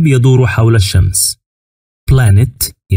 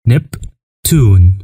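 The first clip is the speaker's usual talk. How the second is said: drawn-out word; 2 semitones lower, much slower than usual. Shown as a demonstration. Teacher drawing out "نبتون" for pupils to repeat. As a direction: say "نبتون" slowly with the final n held long. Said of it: "repeating planet names for students".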